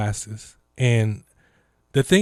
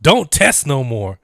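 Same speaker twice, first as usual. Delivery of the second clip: mocking Trump administration; 3 semitones higher, 7 dB louder, slower than usual. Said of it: "telling you".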